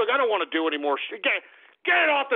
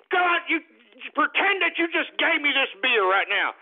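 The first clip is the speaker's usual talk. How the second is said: ordinary delivery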